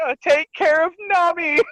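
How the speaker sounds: high-pitched imitation of a character; squeaky mock send-off; mock gushing plea. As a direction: pitch it way up, hammed-up. Sanji unexpectedly not mad, acted.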